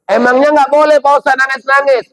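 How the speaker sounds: preacher voices a listener asking about crying. Retold or imitated. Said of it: "Ada lagi yang nanya".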